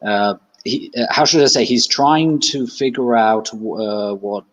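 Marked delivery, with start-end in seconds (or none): none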